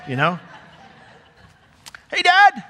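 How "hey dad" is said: high, loud bright hello; cheerful greeting. Pretend. Jesus greets the Father, bouncy high hello. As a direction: call out happily to Dad.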